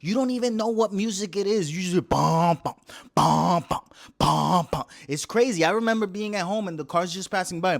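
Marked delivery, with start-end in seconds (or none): 2.1-4.8 loud mouth-made subwoofer booms; imitated bass thump. Describing car show music, loud bass booms.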